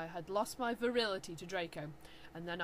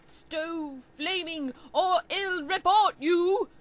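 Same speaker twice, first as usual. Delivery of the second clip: slow, loud, higher indignant threat; mock angry author rant; 8 semitones higher, 12 dB louder, slower than usual. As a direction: ham up the outraged author. Author's note ranting at flamers.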